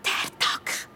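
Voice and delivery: soft voiceless whisper of alarm. Acted. Rosi and Musa whisper together in hiding. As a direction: hiss the name under your breath so he can't hear. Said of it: "flüstert Rosi und der Musa gleichzeitig".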